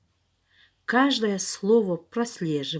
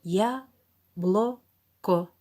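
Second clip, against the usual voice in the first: dictating; much slower than usual. Shown as a demonstration. Dictation word very slow.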